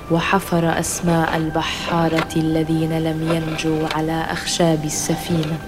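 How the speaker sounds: ordinary delivery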